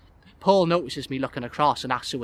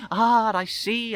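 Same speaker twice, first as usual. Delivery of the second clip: drawn-out, higher mock objection; fake protest; 8 semitones higher, 4 dB louder, much slower than usual. A narrator's reading. Guest joking with waiter about table, fake protest. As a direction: feign a slow, knowing protest. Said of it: "I demonstrate a fake protest".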